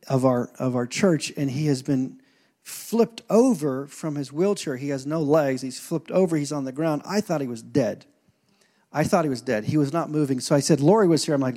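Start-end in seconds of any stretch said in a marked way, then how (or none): none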